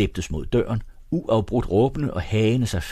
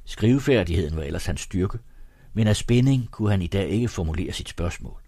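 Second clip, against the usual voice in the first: ordinary delivery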